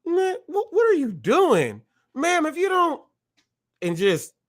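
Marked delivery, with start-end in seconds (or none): 0.0-3.0 high, far-off startled cop voice, much higher; mock startled cop. Venting on a livestream, acting out a cop's high startled reaction. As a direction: play the cop who can't believe the push. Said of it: "would I have like".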